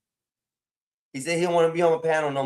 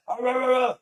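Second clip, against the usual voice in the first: mock angry ranting; 10 semitones higher than usual. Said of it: "He was like".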